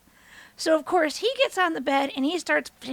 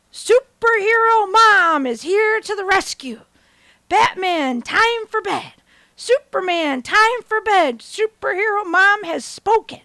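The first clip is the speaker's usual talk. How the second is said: loud, high heroic proclamation; superhero mom; 6 semitones higher, 7 dB louder, slower than usual. Retold or imitated. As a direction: announce it grandly, drawn out like a superhero. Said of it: "as if I am the superhero".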